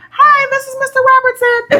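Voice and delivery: mock high, sweet-talking phone greeting; bass-free phone voice. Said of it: "get rid of that bass in your voice".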